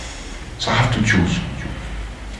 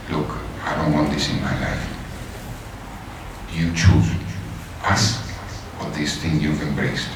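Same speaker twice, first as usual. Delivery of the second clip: softer, low and grave; quiet ultimatum; 5 semitones lower, 9 dB quieter than usual. Retold or imitated. Wife telling him to choose family or faith, quiet. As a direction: say it hushed and final.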